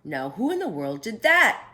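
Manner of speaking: loud startled night watchman; exclaimed. A narrator's reading. Night watchman hearing the crash in the store, a loud startled exclamation. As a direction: call out into the dark store, surprised. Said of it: "he exclaimed".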